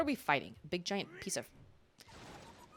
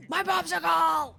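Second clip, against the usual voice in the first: slow, higher shouting bear voice; angry boss bear; 8 semitones higher, 5 dB louder than usual. Acted. Gamer voices the boss bear over its popsicle, shouting. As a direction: wail it as the robbed bear.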